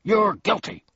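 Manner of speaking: policeman shouting accusations. A narrator's reading. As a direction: shout it out high and loud. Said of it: "stopping only long enough to shout".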